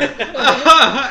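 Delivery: loud, high forced laugh; fake laughing. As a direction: laugh like it's staged. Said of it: "doing the fake laughing".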